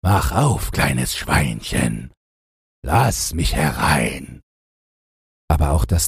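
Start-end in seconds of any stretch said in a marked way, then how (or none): 0.0-4.4 slow, drawn-out wolf's call; lightly voiced wolf, stretching out the words. A narrator's reading. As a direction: call slowly through the door as the wolf. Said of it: "Er klopfte an die Tür und rief".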